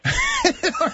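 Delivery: sudden high laugh; high burst of laughter. Real, not acted. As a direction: crack up laughing. Host cracks up at joke.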